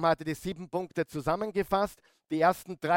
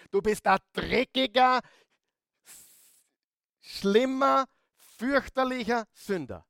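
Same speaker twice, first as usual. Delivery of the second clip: mock accusation; 8 semitones higher, 4 dB louder than usual. Preacher mock-accuses the congregation.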